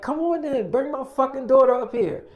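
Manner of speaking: acting out a fed-up parent; impatient demand. Parent orders daughter brought upstairs, impatient. Second impatient call downstairs.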